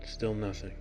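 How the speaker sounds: ordinary delivery, nothing marked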